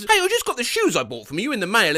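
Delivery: ordinary talk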